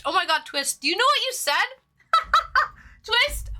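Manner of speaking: cracking up in disbelief; laughing shock. Real, not acted.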